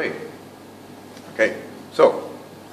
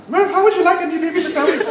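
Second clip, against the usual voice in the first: much higher, fast, laughing mock mother; hammed-up mom voice; 4 dB louder, faster than usual. Shown as a demonstration. Teacher mocking an overacted mom voice, shrill and fast. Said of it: "I certainly don't go".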